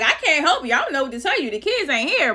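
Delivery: high and loud, insisting they aren't here; frantic denial. Retold or imitated. To the panicking dad on the phone, flustered high insistence. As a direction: rush it out, flustered and defensive. Retelling phone call to Hunter.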